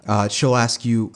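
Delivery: ordinary delivery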